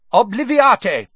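high, loud sharp command; sharp spell. A narrator's reading. Wizard casting a memory charm on the owner, sharp and loud. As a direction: snap the spell out. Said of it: "he said sharply".

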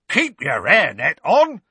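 Mundungus voice. A narrator's reading. Mundungus cowering.

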